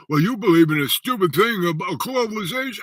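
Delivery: high, loud mimicry of a doubter; mocking scoffer. Critics scoffing at his globalization talk, mock scorn.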